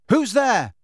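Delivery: high, loud wary call. Acted. Harry calling toward a scuffing sound, wary call. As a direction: call into the room toward a noise. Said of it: "he called".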